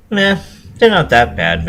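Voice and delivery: pony playing it cool about the stink; dismissive retort. A narrator's reading. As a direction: brush it off as no big deal.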